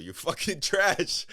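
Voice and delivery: laughing loud jab, high; mock insult. Joking to the player, a loud laughing insult. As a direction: yell it through a laugh as a joke.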